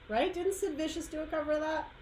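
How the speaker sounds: ordinary talk, nothing marked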